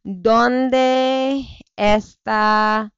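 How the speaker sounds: slow, halting attempt at Spanish. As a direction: grope for the Spanish words slowly. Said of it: "I don't know what work is in Spanish".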